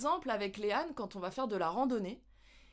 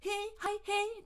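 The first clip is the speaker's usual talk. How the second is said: much higher hi, hey; quick squeaky greeting; 11 semitones higher, much slower than usual. Shown as a demonstration. Showing viewers the quick high Kiwi greeting. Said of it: "vous voulez lui dire bonjour".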